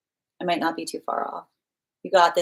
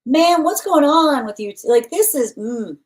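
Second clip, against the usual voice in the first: surprised; 8 semitones higher, 6 dB louder than usual. Real, not acted. Reacting to drawn cards.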